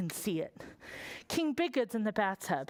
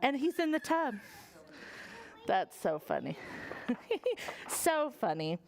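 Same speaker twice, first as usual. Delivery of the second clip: usual speaking voice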